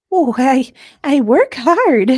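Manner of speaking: high, loud, giggly fluster; flustered Kate. Acted. Kate flustered by Liam's compliment, high nervous squeak. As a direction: squeak it out, caught off guard.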